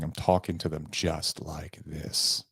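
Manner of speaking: slow, soft, low emphasis demo. Shown as a demonstration. Demonstrating to salespeople how he emphasizes, slow and soft. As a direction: lean in and drag each word out quietly. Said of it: "And I slow it down".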